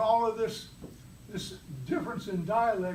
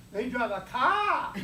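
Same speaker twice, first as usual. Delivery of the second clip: put-on slow, drawn-out Boston accent, car said as cah; imitating a Boston accent, dropping the r in car; mock Boston accent; 2 semitones higher, 3 dB louder, slower than usual. Speaker mocking Bostonians to the club, slow Boston accent. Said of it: "They talk funny back there".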